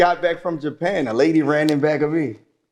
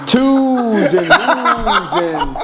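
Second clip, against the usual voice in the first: drawn-out, higher Baltimore vowels with laughter; Baltimore accent; 5 semitones higher, 4 dB louder, much slower than usual. Shown as a demonstration. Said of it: "You gonna say Baltimore".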